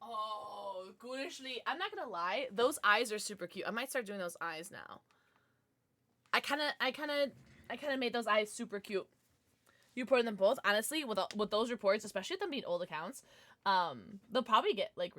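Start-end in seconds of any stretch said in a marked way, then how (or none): none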